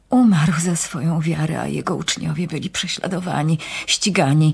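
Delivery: ordinary delivery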